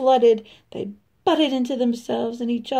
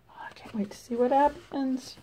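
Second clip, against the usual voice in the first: softer whispered aside; excited whisper; 8 dB quieter than usual. Real, not acted. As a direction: lean in and whisper the suspense. Storyteller aside to listener.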